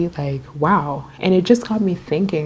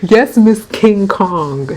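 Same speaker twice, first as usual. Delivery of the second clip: louder and slow, dragged-out taunt; mocking insult; 4 semitones higher, 7 dB louder, much slower than usual. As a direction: call it out like a playground jeer. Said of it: "she called me that".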